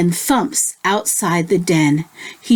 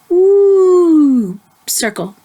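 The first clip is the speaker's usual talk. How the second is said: playful ooh; 7 semitones higher, 5 dB louder, much slower than usual. Acted. Teacher drawing circle with kids.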